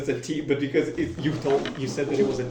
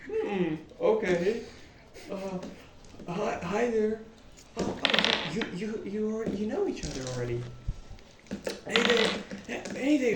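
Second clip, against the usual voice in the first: lightly voiced hesitant, higher-pitched greeter; awkward guild contact; 6 semitones higher than usual. Guild contact greets the party at the stairs, awkward. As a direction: stumble through a higher, halting hello.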